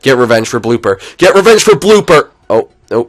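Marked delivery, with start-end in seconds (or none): 1.2-2.3 high, loud battle cry; rallying shout. Real, not acted. Gamer urging next batter on, rallying shout.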